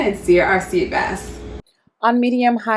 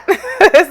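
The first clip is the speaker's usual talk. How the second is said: burst of laughter; 11 semitones higher, 5 dB louder than usual. Real, not acted. Laughs at thick sauce.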